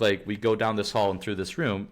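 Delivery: ordinary talk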